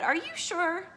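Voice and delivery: high-pitched storybook questioning; doubting duck. A narrator's reading. Duck doubting the hen's story, high-pitched.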